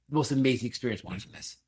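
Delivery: ordinary talk, nothing marked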